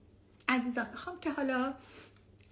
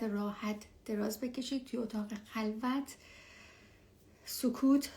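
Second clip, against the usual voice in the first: guided meditation. Acted. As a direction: ease them gently into stillness. Leads a meditation.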